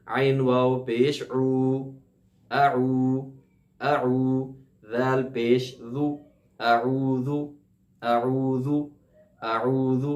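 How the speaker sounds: slow spelled-out letter drill; slow recitation drill. Shown as a demonstration. Teacher drilling students letter by letter. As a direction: dictate each sound slowly for repeating. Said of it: "میرے ساتھ دہرائیے".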